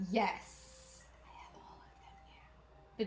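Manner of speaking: excited little whisper; hushed gleeful whisper, breath only. Real, not acted. Crafter sharing her full floss collection in a delighted whisper.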